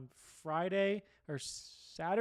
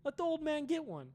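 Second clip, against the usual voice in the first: pleading; 10 semitones higher, 4 dB quieter than usual. Retold or imitated. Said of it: "I was like".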